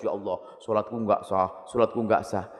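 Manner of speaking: soft, low fretful lament; anxious prayer. Retold or imitated. Anxious worshipper praying alone, soft and low fretful. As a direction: murmur it like a worried old worshipper.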